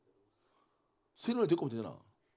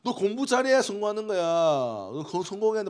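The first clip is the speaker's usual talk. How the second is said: loud, high-pitched parental nagging; mock parent shouting about success; 7 semitones higher, 7 dB louder than usual. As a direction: push the kid hard like a pushy parent. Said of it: "부모님이 사랑한다는 표현을 어떻게 하십니까".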